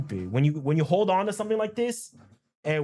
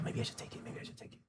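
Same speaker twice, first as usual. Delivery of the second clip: whispered to himself; a hushed private whisper; 17 dB quieter, much faster than usual. Real, not acted. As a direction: breathe it under his breath. Muttering over a trade.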